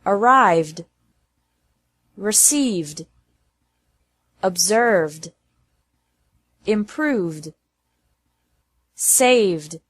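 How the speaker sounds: "Arrived, received, observed, improved, saved" closing on a voiced d. Shown as a demonstration. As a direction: end in d, no extra syllable. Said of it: "pronounced like D after verbs".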